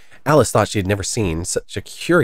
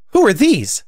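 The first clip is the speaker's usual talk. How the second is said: high, loud, demanding queen; imperious queen; 11 semitones higher, 5 dB louder than usual. A narrator's reading. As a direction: demand it sharply in a raised queenly voice. Imperious Queen questions the gardeners.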